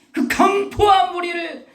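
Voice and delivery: high-pitched, forceful pulpit delivery; preaching cry. Real, not acted. (목소리를 높인 설교)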